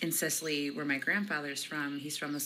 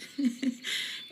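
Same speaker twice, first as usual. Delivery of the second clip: high laugh; 10 semitones higher than usual. Real, not acted. Laughing at her imitation.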